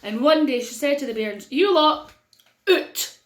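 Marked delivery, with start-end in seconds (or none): 1.5-3.2 put-on calling the kids out. Mother ordering kids out.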